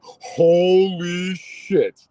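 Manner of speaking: long drawn-out, high and loud exclamation; stunned shock. Retold or imitated. Boy looks down at the branch in his leg, stunned. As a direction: stretch it out in loud, horrified amazement. Retold shock at seeing wound.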